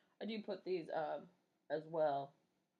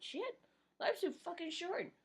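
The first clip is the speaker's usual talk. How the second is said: exasperated; 10 semitones higher than usual. Real, not acted. Exasperated aside.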